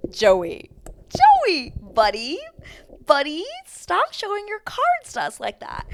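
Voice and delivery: high loud cry of Joey's name; exasperated plea. Real, not acted. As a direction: beg him to stop, half laughing. Pleading with Joey on screen.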